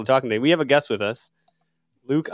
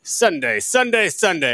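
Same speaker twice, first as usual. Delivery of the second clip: monster truck announcer; 7 semitones higher, 4 dB louder than usual. Pretend. Monster-truck style promo chant.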